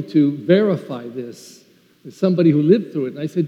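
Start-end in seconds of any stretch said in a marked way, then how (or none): none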